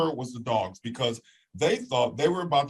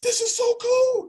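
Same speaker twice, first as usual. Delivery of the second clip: very high awed squeal; amazed. Retold or imitated. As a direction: squeal it with wonder. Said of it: "It was just like".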